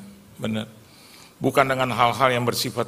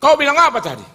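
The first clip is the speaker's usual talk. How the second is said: much higher, louder challenge; menacing demand; 7 dB louder than usual. Retold or imitated. Big man confronts him, loud high challenge. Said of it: "dia bilang".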